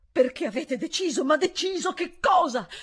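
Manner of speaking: frightened housekeeper shouting her question; anxious shout. Acted. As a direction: demand the answer in panic. Anxious housekeeper presses governess.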